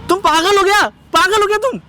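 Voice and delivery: high-pitched indignant outburst; outraged. Acted. Husband reacts to wife's taunt about his mother, outraged. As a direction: fire it back in outrage, voice jumping up.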